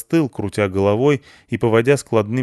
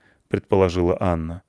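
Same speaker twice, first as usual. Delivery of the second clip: ordinary delivery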